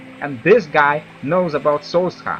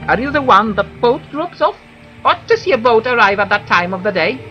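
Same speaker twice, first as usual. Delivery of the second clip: higher whiny villager voice; Fargoth; 7 semitones higher, 4 dB louder than usual. Acted. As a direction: voice a fussy whiny elf. NPC Fargoth dialogue voiced.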